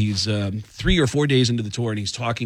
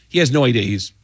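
ordinary talk

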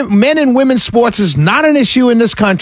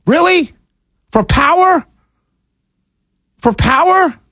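high, incredulous questioning; outraged short questions; indignant disbelief; 5 semitones higher than usual. Real, not acted. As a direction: challenge them, pitch lifted high. Indignant challenge to politicians.

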